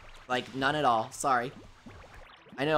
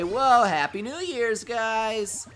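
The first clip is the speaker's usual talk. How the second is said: high, drawn-out festive greeting; acted New Year's whoop; mock celebrating; 6 semitones higher, 3 dB louder, much slower than usual. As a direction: cheer it out to everyone, a bit put-on. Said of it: "I'll say".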